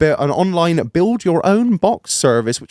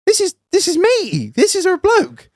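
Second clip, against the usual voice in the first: dawning horror. Retold or imitated. Realising who the bloke is.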